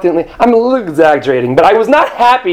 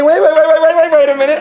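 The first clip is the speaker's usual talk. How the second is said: high, rapid-fire stammer of disbelief; flustered protest; 10 semitones higher, faster than usual. Acted. Traveler handed first class ticket, high flustered protest. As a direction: interrupt in a high, hammed-up panic.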